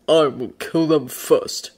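slow, certain bear voice; sure, stolid bear. A narrator's reading. Bear answers about the attackers, sure of himself. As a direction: state it slowly with total certainty. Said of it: "as sure in his actions".

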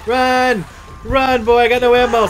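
loud urgent yelling; shouting. Real, not acted. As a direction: yell at him to run. Urging teammate to run.

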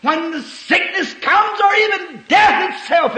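high, forceful proclamation; fervent preaching cry. Real, not acted. Preacher assuring believers facing sickness and death, high fervent cry. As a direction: raise the voice high with fervor.

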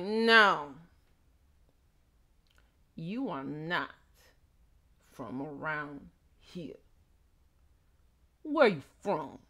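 slow, heavily paused challenge; movie-style intimidation of an outsider; intimidating local. Retold or imitated. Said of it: "It's all intimidating and stuff".

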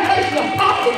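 high, loud preaching cry; preaching shout. Real, not acted. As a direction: lift it up into a full preaching shout. Preacher building to a peak.

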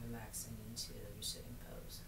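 quick soft whisper. Acted. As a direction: whisper the cue to settle them. Cueing relaxation.

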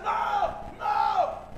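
loud mock scream. Disciples scream as the shadow comes near, hammed-up screaming. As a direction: cry out in pretend terror. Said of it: "Así que gritan".

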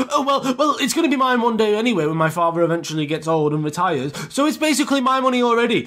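high, smug mock retort; bratty rich kid. Rich kid claiming his father's money to the teacher, mock haughty. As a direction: imitate a spoilt kid having the last word. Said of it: "his head's all, like, you know, shaking".

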